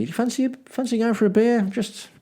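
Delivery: high-pitched, tentative chat-up; imitating a friendly invite, pitched right up; friendly invitation. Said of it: "just being generally friendly".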